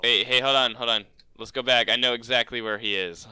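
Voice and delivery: loud call to stop the group. Real, not acted. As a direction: shout to teammates to hold up. Calling teammates back.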